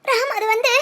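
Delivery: halting words through sobs; crying. Acted. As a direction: try to explain while weeping. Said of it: "ஏன் இப்படி அழுகுற". (அழுதுகொண்டே)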